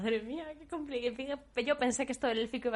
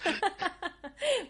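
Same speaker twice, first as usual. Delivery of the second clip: laughter; 9 semitones higher, 3 dB louder than usual. Real, not acted. Reacting to hard lesson.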